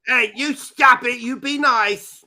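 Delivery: loud, high telling-off; mock scolding. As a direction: scold them loudly, stretching the last words.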